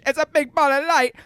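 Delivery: high, put-on British accent. Shown as a demonstration. Mock British for the podcast hosts. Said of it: "I do British".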